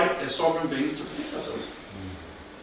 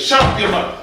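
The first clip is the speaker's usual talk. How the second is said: louder, higher snapping yell at the dog; angry yell; 6 semitones higher, 10 dB louder than usual. Retold or imitated. Colonel yells at the barking dog, angry and loud. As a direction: bark it out, fed up with the noise. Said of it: "And Colonel goes".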